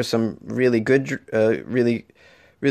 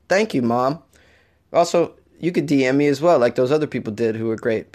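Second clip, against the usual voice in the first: normal talking voice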